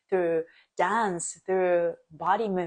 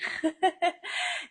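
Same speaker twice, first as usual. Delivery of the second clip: teary laugh; 10 semitones higher than usual. Real, not acted. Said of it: "maybe that's why I'm crying".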